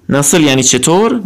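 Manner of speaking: normal talking voice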